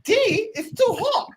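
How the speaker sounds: put-on high, incredulous childhood self; retold bafflement. Replaying own confused protest to mum, high and baffled. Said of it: "I was like, "Huh?".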